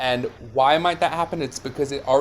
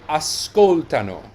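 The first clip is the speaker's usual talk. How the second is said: ascoltano with a strong SCOL, light tano; stress on co; 3 semitones higher, 4 dB louder than usual. Shown as a demonstration. Teacher showing the they form keeps its stress, SCOL emphasised. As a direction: hit SCOL in ascoltano, let the ending fall. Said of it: "here it stays on co".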